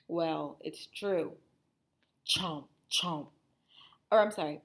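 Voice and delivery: ordinary delivery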